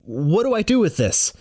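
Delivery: high, strained plea for help; panicked question. Acted. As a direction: ask helplessly, pitch jumping up. Narrator panics holding a snake.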